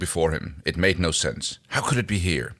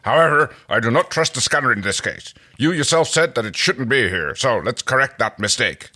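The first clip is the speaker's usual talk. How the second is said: loud, higher, aggressive warrior; Dargo voice; 5 semitones higher, 5 dB louder than usual. Acted. Dargo deciding to destroy the box, loud warrior voice. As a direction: push it hard, ready to fight. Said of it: "That's actually a really good Dargo".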